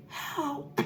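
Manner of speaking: faint, distant "Help"; weak voice from far away; feeble voice. Retold or imitated. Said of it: "my feeble voice far away".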